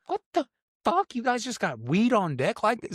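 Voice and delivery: high-pitched mock astonishment; incredulous squeal of an impression; amazed disbelief. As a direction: imitate a flabbergasted out-of-towner, voice leaping way up. Mimics amazed out-of-towners.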